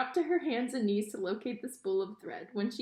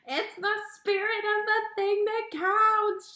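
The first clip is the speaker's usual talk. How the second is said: high, sing-song shout; 10 semitones higher, 5 dB louder than usual. A narrator's reading. Pea shouts the line back to Mig, sing-song. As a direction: belts it out like a tune. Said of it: "shouted Pea".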